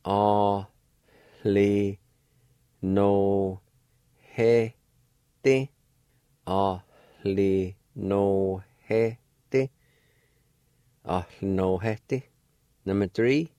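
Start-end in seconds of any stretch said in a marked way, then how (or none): 0.1-5.7 A, li, no, he, Te sounded out with a faint breathy H on he; syllable by syllable. Shown as a demonstration. Teacher shows learners the faint H sound, syllable by syllable. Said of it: "an aspirated sound like this". 6.5-9.7 Ahli no he te stretched slowly with the H breathed; slow demo. Shown as a demonstration. Teacher models the aspirated word slowly for learners. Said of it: "an aspirated sound like this".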